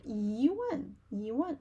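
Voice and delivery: yi gliding up, wan falling; rising tone on yi. Shown as a demonstration. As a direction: lift yi, then drop wan. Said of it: "rising tone".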